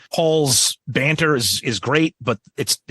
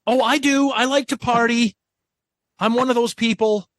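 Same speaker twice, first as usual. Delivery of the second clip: mock eager fan; 10 semitones higher than usual. Mock crowd member answering.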